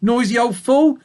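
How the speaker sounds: Fly scolding the sheep next door; barked insult. Acted. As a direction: bark it with contempt. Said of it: "She barked".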